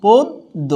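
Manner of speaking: পদ্য said as "পোদ্দো", with a doubled দ; model pronunciation. Shown as a demonstration. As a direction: give পদ্য with the দ held double. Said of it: "পদ্য হয়ে যাচ্ছে".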